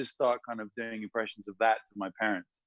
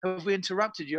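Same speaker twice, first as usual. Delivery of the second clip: apologetic BBC caller; 10 semitones higher, faster than usual. Retold or imitated. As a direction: apologise profusely in a high phone voice. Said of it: "They're like".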